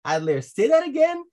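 can't believe the number you just heard; stunned disbelief. Retold or imitated. Preacher retelling asking Adler to repeat, astonished. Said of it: "I asked Adler".

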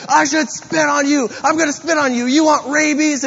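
high-pitched mock threats; imitated yelling. Homeless woman threatening to spit on him, imitated yelling. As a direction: act out an agitated woman yelling at a stranger. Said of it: "she's just yelling at me".

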